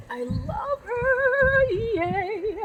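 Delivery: high, slow, gushing over her; a high, drawn-out coo of delight; delighted squeal. Real, not acted. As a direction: squeal with love for the plant. Gushing over a clearance plant.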